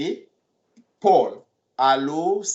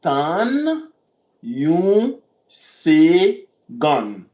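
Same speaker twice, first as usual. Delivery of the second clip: Tan yon segon stretched very slowly; much slower than usual. Shown as a demonstration.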